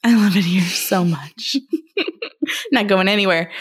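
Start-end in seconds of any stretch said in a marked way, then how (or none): 0.0-2.7 laughing while repeating "here". Shown as a demonstration. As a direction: say "I love it here" through a laugh. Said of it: "Do you love it there?".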